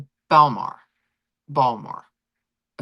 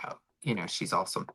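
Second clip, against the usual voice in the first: softer, low murmur of the husband; soft husband quote; 10 semitones lower, 11 dB quieter, faster than usual. Retold or imitated. Wife quoting husband making up after a fight, soft and low. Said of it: "he's gonna be like".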